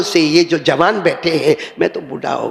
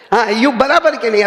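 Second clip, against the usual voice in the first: ordinary talk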